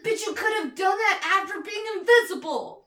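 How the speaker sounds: high, loud, incredulous rant; exasperated outburst. Retold or imitated. Viewer ranting at Tigra for wasting invisibility, high and loud. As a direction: berate him like he's hopeless.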